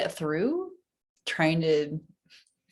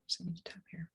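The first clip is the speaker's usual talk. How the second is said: breathy whisper to herself; 15 dB quieter, faster than usual. Real, not acted. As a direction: whisper it under your breath. Whispered aside while tapping.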